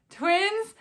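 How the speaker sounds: high, loud incredulous "twins"; husband's disbelief. Retold or imitated. Imitating husband still shocked mid-pregnancy, high incredulous. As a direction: echo the husband's shock again.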